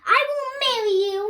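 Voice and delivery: high, loud and fast with joy; joyful bride-to-be; happy reply. A narrator's reading. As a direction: gush it out happily. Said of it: "she said happily".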